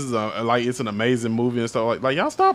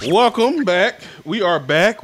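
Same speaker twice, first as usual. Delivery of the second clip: loud, high, hyped host welcome; excited welcome back; 7 semitones higher, 5 dB louder, slower than usual. Real, not acted. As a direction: bring the energy back after the break. Host returns from break.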